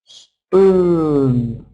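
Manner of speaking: "Spoon" stretched on the oo; long oo vowel. Shown as a demonstration. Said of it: "Long or short?".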